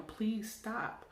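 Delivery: normal talking voice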